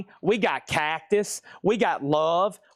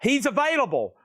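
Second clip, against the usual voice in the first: excited alarm; 4 semitones higher than usual. Real, not acted. Excited alarm over big bid.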